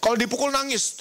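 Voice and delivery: ordinary talk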